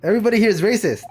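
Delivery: shrill-free exasperated exclamation; exasperated outburst. Real, not acted. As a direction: blurt it out in disbelief. Outburst after the sailor's insult.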